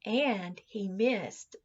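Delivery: ordinary delivery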